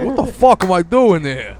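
loud, high North Jersey bewilderment; New Jersey accent. Acted. Cambodian man waking from coma, baffled loud New Jersey accent. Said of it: "like, a northern New Jersey accent".

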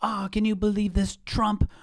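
put-on a light sketch of the chatty old lady down the street; old neighbour lady. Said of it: "She says".

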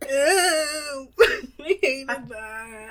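high, loud, drawn-out revulsion with laughing; real disgust. Real, not acted. Co-host reacting to the creepy impression, real disgust. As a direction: squeal it out, grossed out but amused.

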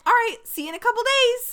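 high, loud hammed-up sign-off; mock breezy goodbye. Co-host mimicking the Bachelor's exit, mock breezy. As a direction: toss off an exaggerated sing-out farewell.

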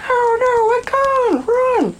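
high-pitched imitation of a fleeing crowd; mock panicked cry. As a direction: cry out a warning in pretend panic. Voices crowd fleeing a gun.

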